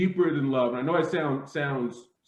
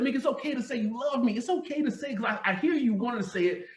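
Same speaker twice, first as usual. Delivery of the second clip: gentle coaxing; 7 semitones higher than usual. Retold or imitated. On the phone urging Mika to say it, gently coaxing. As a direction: coax her gently to say it.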